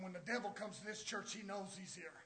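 normal talking voice

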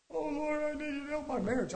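hammed-up plea to the Lord; mock pleading prayer. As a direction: wail it like a needy petitioner. Mimics someone begging God.

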